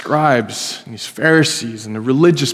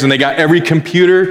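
ordinary talk, nothing marked

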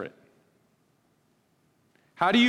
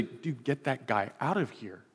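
softer, hushed aside; inconspicuous; 2 semitones lower, 9 dB quieter than usual. Retold or imitated. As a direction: tell the usher softly so no one notices. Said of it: "like, inconspicuously".

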